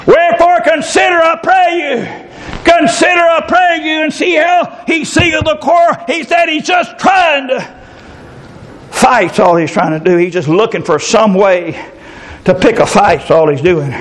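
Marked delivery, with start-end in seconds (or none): 0.0-9.3 raised, fervent preacher's voice, slowing on key words; preaching at full force. Acted. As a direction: preach it out to the congregation.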